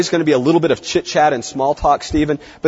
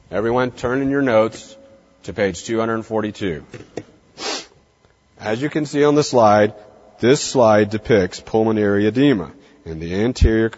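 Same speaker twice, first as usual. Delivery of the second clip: monotone professor, low and lifeless; uninspiring lecturer; 5 semitones lower than usual. Acted. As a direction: drone through the lecture with long pauses.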